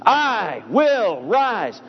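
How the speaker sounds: I, WILL and RISE each punched high; all three words emphasized. Shown as a demonstration. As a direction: slowly hit I, WILL, RISE one by one. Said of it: "when all three are emphasized".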